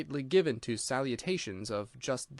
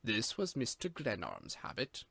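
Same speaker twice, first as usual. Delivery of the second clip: solemn butler; 3 semitones lower, 7 dB quieter than usual. A narrator's reading. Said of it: "and explained".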